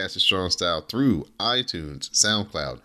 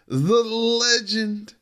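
ring announcer; 12 semitones higher, slower than usual. Acted. Announcing a wrestler's entrance.

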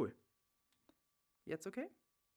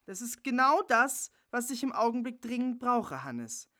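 indignant; 8 semitones higher, 4 dB louder than usual. Acted. Jana defending her camp.

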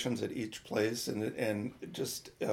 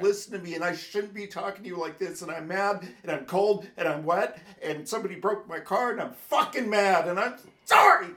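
retold frustrated rant; 6 semitones higher than usual. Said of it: "I'm like".

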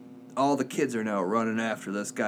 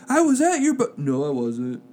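excited slip, hastily taken back in a usual voice; cutting himself off; 2 semitones higher, 5 dB louder than usual. Retold or imitated. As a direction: blurt it out, break off mid-word, backtrack. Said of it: "like, he's cutting himself off".

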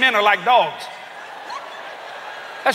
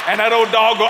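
ordinary talk

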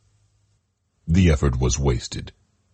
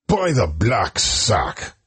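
slow, pitched-up oath; swearing; 6 semitones higher, slower than usual. Acted. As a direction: swear it at the vanishing coach. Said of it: "swore Bidworthy".

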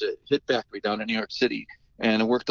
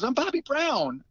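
insisting on his name; 13 semitones higher, slower than usual. Retold or imitated. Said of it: "he kept on saying it".

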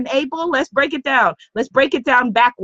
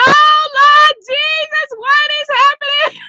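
mock panic; 5 dB louder than usual. Retold panic after coaching advice.

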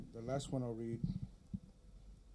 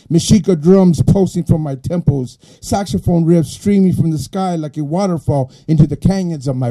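louder, higher declaimed verse, building in force; poetry recitation; 5 semitones higher, 19 dB louder than usual. Acted. Poet reads his sobriety poem to the audience, declaimed. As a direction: declaim it like an incantation, line upon line.

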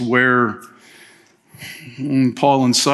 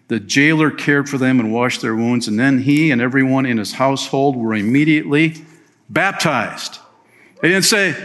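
ordinary talk

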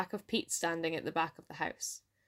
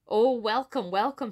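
higher, raised storybook welcome; gleeful cry; 4 semitones higher, 6 dB louder than usual. A narrator's reading. Hungry fox greeting the bannock, gleeful cry. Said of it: "he cried out".

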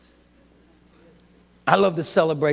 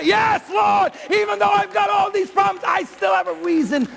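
joyful shout; 12 semitones higher than usual. Pretend. Worshipper shouts praise despite problems, high joyful shout. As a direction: cry out in triumph. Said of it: "You'll just say".

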